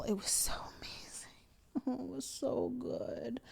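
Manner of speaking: hushed and breathy with wonder; a reverent hushed gush; awed whisper. Real, not acted. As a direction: whisper it like it's too good to say aloud. Gushing about the show.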